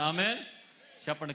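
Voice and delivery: loud Amen with a rising end; congregational prompt. Real, not acted. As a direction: fling Amen up to the crowd. (గట్టిగా పైకి లేపే ఆమేన్)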